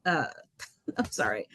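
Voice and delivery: real emotion catching in the throat, long pauses; choked up. Real, not acted. Official overcome mid-speech thanking student advocates, choked up. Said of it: "I'm getting a little emotional here".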